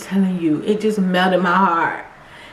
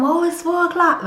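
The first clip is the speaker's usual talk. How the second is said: reluctant excuse; 7 semitones higher than usual. Retold or imitated. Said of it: "trying to come up with excuses".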